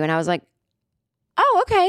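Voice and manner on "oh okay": very high, re-enacted polite okay; taken-aback acceptance. Customer told someone else will serve her, high taken-aback okay. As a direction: accept it awkwardly, voice pitched way up.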